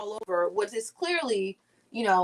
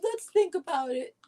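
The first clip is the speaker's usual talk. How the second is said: mock hesitant Democrat; 10 semitones higher, slower than usual. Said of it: "Biden and the Democrats are like".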